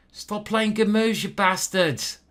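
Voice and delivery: high, loud yell at opponents; exasperated shout. Real, not acted. Cursing at opponents' good moves.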